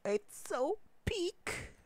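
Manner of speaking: drawn-out, very high-pitched fangirl squeal; gushing squeal. Real, not acted. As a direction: squeal it slowly, overcome with delight. Gushing over a favorite chapter.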